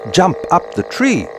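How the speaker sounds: plain reading voice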